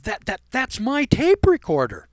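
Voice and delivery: shocked blurt. Retold or imitated. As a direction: sputter it out, outraged and stunned. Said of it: "He blurted out".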